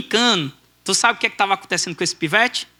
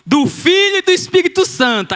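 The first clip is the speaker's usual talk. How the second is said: high, slow ringing declaration; baptism proclamation; 10 semitones higher than usual. Retold or imitated. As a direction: proclaim the baptism over the crowd, building to the end. Acted baptism proclamation amid shouting.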